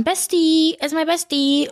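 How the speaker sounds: high gushing bestie voice; Gen Z voice. Retold or imitated. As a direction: gush like a Gen Z friend. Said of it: "dass wir immer sagen".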